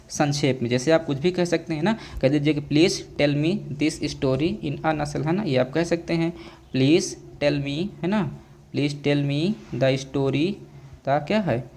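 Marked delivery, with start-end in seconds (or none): 2.6-5.3 slow, word-by-word example; slow classroom dictation; slow dictation pace. Shown as a demonstration. Teacher dictating an example sentence. 6.7-10.5 slow, word-by-word example; slow classroom dictation; slow dictation pace. Shown as a demonstration. Teacher dictating piece by piece.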